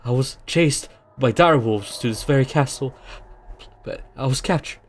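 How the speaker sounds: lightly voiced pleading prisoner; desperate prisoner. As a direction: beg to be freed, rising urgency.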